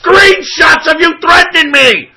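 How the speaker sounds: real indignant yelling; angry accusation. Real, not acted. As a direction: hammer the accusation at high pitch. Angry quarrel, repeats accusation.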